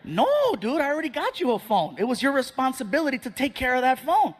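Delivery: indignant parent laying down responsibility; exasperated refusal. Acted. Exasperated dad refuses a new phone.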